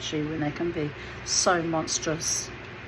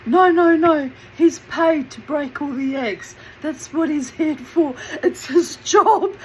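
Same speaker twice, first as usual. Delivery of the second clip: boss explaining; 9 semitones higher, 7 dB louder than usual. Retold or imitated.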